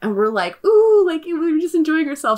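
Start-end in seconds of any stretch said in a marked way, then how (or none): 0.7-1.0 high, loud, drawn-out ooh; excited high-pitched ooh; delighted "ooh". Retold or imitated. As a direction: coo with exaggerated enjoyment. Retold delight at dinner out.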